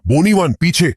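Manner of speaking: high-pitched warning cry, no big volume; narrator's acted shout of alarm; urgent shout. A narrator's reading. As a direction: call out a sudden warning, pitch jumping up. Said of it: "वो तुरंत चिल्लाता है".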